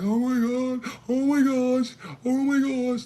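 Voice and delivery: high shrill loud wailing; mock the frozen panicking mother, loud; imitating hysterical mom. Mother freaking out beside the crash instead of helping her kids, high wail. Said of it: "That's all she kept saying".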